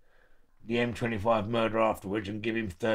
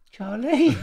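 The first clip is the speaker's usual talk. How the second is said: high call; 13 semitones higher, 6 dB louder than usual. Retold or imitated. Calling out in fear.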